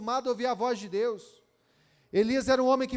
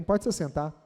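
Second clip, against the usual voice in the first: normal talking voice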